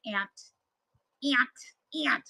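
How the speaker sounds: Buffalo-accented "aunt", said like "ant". Shown as a demonstration. Showing viewers her Buffalo "aunt", pronounced ant. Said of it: "Those are my Buffalo accent".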